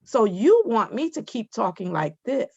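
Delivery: normal talking voice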